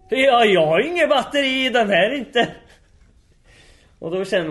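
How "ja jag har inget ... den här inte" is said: loud, high mock protest; imitated old man's excuse. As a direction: blurt the excuse out at full voice. Gubben svarar med bortförklaring. (härmad gubbe som högljutt bortförklarar sig)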